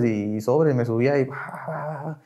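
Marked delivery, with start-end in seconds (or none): 1.3-2.2 very soft mock babble; imitated tearful outburst. Climbing up to vent at the tribuna, hushed mock outburst. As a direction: mimic venting in a hushed stream of noises. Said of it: "donde empezaba a llorar".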